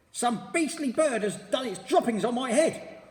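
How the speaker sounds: high, loud, disgusted complaint; outraged at the mess. A narrator's reading. Missus Twit complaining loudly about bird droppings.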